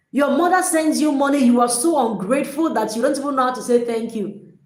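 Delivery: ordinary talk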